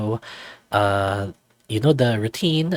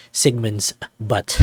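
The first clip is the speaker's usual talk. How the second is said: normal talking voice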